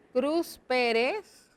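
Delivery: Cruz Pérez spelled out slowly while writing; drawn-out, word by word as if writing it down; dictating at writing speed; slow dictation. Shown as a demonstration. Teacher writing the name out slowly.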